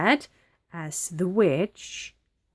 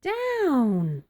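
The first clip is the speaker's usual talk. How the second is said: witch crying out; 5 semitones higher than usual. A narrator's reading. Witch orders broomstick down after losing bow, crying out. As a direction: call it out from high, sliding down. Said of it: "cried the witch".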